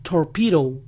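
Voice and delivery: "Torpedo" as "tor-PEE-row"; tapped D and gliding final O in "Torpedo"; American "Torpedo", E said as I; English pronunciation. Shown as a demonstration. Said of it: "la O final suena O".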